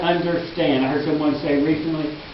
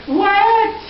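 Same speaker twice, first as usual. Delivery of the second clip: much higher, incredulous outburst; indignant disbelief; 12 semitones higher, slower than usual. Acted. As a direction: shoot up in pitch, outraged and disbelieving. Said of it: "they had indignation".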